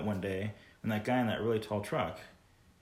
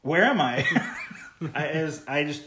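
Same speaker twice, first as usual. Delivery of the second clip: louder, laughing incredulous question; amused shock at a stranger's friendliness; laughing disbelief; 6 semitones higher, 7 dB louder than usual. Real, not acted.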